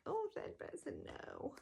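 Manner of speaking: hammed-up dismissive reader; mock disdain. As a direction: sneer at third person like a snob. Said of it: "people usually that is like".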